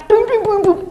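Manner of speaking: imitated bubble-pop booms; quick, high mimicked popping; playful high-pitched boom sounds; high popping noises.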